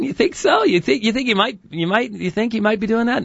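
high, squeaky mock disbelief; mock incredulous voice. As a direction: tease him in a high silly voice. Mocking co-host's cherry picking.

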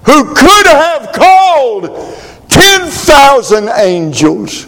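high preached shout; preaching at full force. Acted. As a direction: shout it out from the pulpit. Preaching climax.

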